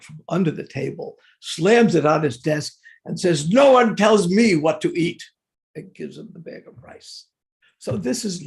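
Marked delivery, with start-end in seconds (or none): 3.5-5.2 louder, a touch of playful indignation; mock indignant declaration. Prabhupada to his cook, hiding rice: louder mock indignation. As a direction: slap it down with a bit of mock outrage.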